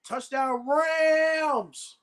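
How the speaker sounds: high, hugely drawn-out call; slow announcer-style scoring cry; touchdown call. Real, not acted. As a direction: hold the last word long and high. Calling out a touchdown.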